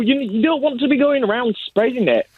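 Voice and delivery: normal talking voice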